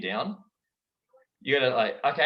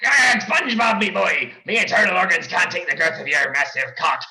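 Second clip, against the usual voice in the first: loud, higher pirate crab; Mr. Krabs voice; 4 semitones higher, 8 dB louder than usual. Acted. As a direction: bellow it like a greedy sea captain. Addresses SpongeBob as "me boy.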